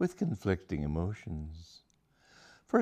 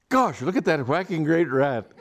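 high, loud, giddy outburst; suddenly thrilled at the sight of a rat; bursting out high and loud with a laugh; excited exclamation; 8 semitones higher, 6 dB louder than usual. Acted. Aubrey spots a rat mid-proposal.

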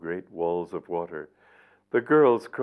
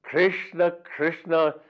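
high, loud mock-outraged yelling of the name; mock angry shout; 9 semitones higher, 4 dB louder, much slower than usual. Vyasa pretending anger at the gopis, loud mock shout. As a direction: bellow it in pretend fury. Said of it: "shouted Vyasa as if in anger".

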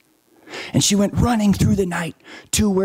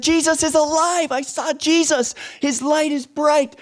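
very high, thrilled exclamation; Mary bursting in to tell the frightened disciples, ecstatic; joyful announcement; 12 semitones higher than usual. Acted. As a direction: burst in overjoyed, pitch way up.

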